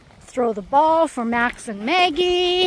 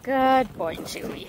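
whispered praise; 2 semitones lower than usual. Real, not acted. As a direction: breathe the praise gently to the dog. Quiet praise to a dog.

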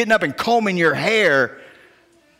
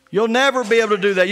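normal talking voice

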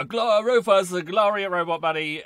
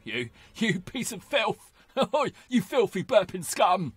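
male Bellatrix impression; slower than usual. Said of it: "That's my male impression of Bellatrix Lestrange".

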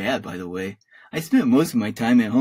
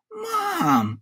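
very high, drawn-out mock scream. A narrator's reading. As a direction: scream for Mom without full volume. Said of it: "I screamed".

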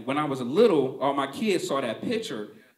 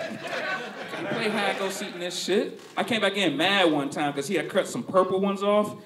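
ordinary delivery

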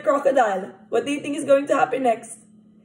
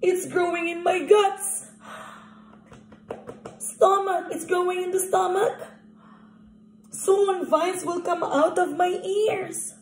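higher, worried crocodile voice; 6 semitones higher than usual. Acted. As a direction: fret a little, pitched up.

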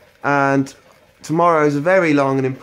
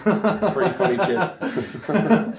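slow, laughing through the words; 3 semitones higher, much slower than usual. Real, not acted. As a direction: laugh as you repeat it. Repeats line laughing.

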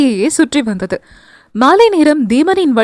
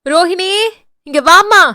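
mother-in-law calling up to Rohini, raised call; 7 semitones higher than usual. Acted. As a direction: call her over from another floor. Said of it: "என்று அழைத்திருந்தார் காமாட்சி".

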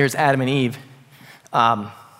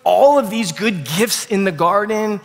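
loving plea; 6 semitones higher, slower than usual. Retold or imitated. God lovingly pleading with Adam and Eve.

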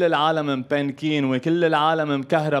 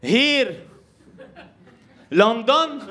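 high, emphatic broken English; grandma's broken English; 5 semitones higher, much slower than usual. Retold or imitated. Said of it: "قالت لها". (ستة تصرّ بإنكليزية مكسرة)

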